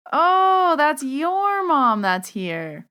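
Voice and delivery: long high, loud "oh", then slowing; retold realisation. Medium tells client whose mom is here, sudden realisation. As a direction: re-enact it dawning on you, drawn out.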